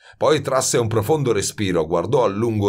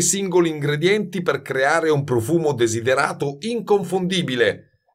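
stern, didactic master; severe master perfumer; 9 semitones higher than usual. A narrator's reading. Master explains what a formula is, severe. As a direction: spell out the definition impatiently.

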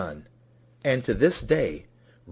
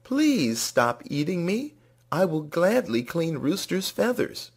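mild yell; 7 semitones higher than usual. A narrator's reading. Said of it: "Grass yelled".

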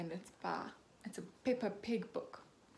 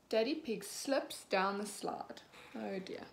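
usual speaking voice

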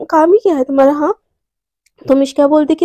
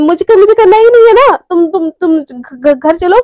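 louder protest breaking into stammers; rattled, stuttering to change the subject; flustered refusal; 4 dB louder than usual. Acted. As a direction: start emphatic, then trip over the words.

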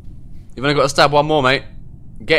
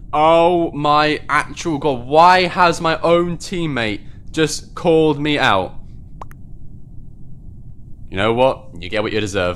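ordinary talk, nothing marked

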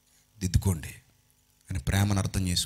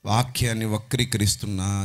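ordinary talk

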